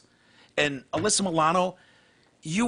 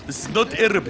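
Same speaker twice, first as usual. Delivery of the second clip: put-on Arabic accent; 6 semitones higher than usual.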